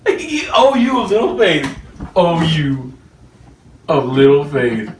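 lightly put-on, sing-song rebuke; a slow, hammed-up tut with a laugh; mock Jesus voice. Preacher mimicking Jesus rebuking Peter, hammed-up and slow. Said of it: "and he says".

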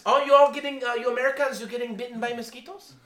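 put-on high, animated Filipino English accent, telling a tall tale. The Filipino pastor brags about commanding a cloud, high Filipino accent. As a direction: retell the story as the Filipino pastor in his accent. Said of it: "he used the Filipino accent".

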